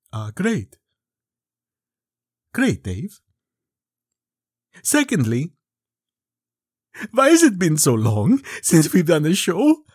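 lightly voiced weary old vampire; a hint of a bored immortal count. Count voice back.

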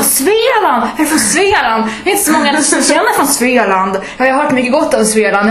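high, hammed-up fascination; mock amazement. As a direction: gush as if meeting an exotic native, in parody. Gushing parody at a Stockholmer.

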